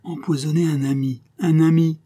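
outraged accusation. A narrator's reading. Drunk locksmith accusing his poisoner, rising to an outraged cry. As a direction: accuse the friend, voice climbing to a cry. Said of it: "accusation d'autant plus terrible".

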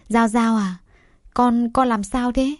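mildly worried, halting mother's question. A narrator's reading. Mother rushes to her fainted daughter, worried. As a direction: ask with a touch of concern, slowing down. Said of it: "rồi lo lắng hỏi".

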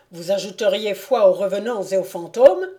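ordinary delivery, nothing marked

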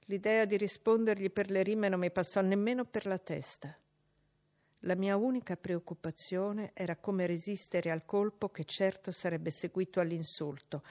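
ordinary delivery

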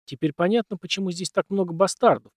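ordinary delivery, nothing marked

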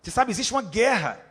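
normal talking voice

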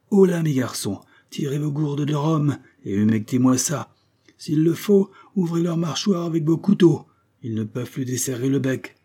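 high-pitched skipper taking charge; urgent captain. Acted. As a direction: direct the sailors loudly and urgently. Captain orders the castaways revived.

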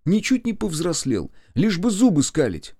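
high-pitched, exasperated telling-off; indignant scolding. Acted. Offended boss scolds the joker. (возмущённо, высоким голосом)

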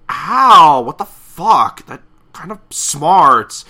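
loud, high, drawn-out complaint; acted pained yelp. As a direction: howl like a zapped cartoon spider. Zapped spider complains.

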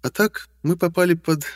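high clear female voice; young woman voice. Acted. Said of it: "заговорила Таша".

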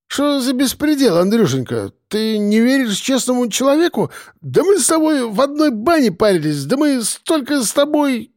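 put-on high-pitched drunken protest; tipsy hunter, aggrieved. A narrator's reading. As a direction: plead wounded honesty in a drunk's high voice. (пьяный обиженный охотник)

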